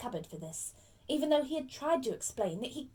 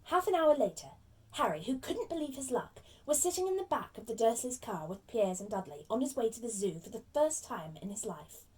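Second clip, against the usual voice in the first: plain reading voice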